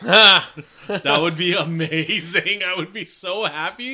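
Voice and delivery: loud, drawn-out delight; excited. Real, not acted. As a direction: gush it slowly, thrilled. Thrilled by a silly idea.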